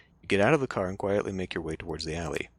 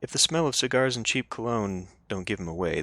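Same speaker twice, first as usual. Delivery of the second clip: ordinary delivery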